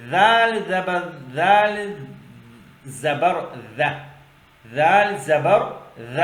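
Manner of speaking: loud, deliberate primer recitation; spelling-out drill. Shown as a demonstration. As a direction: sound out letter, vowel, then syllable for pupils. Teacher spells out letters. (بلند آواز میں ٹھہر ٹھہر کر ہجے)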